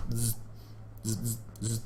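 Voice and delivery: soft, low buzzing bursts; tiny insect buzzes, kept hushed; wasp buzz. Retold or imitated. Sneaking up to the wasp, wasp buzz.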